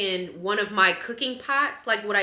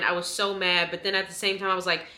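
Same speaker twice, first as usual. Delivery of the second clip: ordinary delivery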